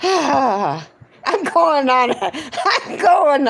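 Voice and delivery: laughing. Real, not acted. Joke about eating copper pipe.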